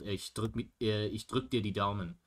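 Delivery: ordinary talk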